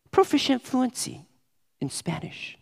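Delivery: lifted, inflated job-application phrasing; mock résumé boast. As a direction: puff it up like a résumé line. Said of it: "you say".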